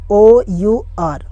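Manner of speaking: very slow, separated letters; one letter at a time; spelling it out. Shown as a demonstration. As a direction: spell it slowly for learners. Spelling a word aloud for learners.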